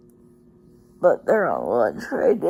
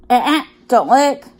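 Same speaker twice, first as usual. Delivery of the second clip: sharp warning to a pet; scolding the dog; 5 semitones higher, 8 dB louder than usual. Real, not acted. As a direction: stop the dog licking, firm.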